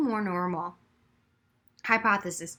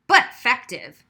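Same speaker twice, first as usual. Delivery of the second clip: louder quip; 9 dB louder than usual. A narrator's reading. Noah interjects pleased.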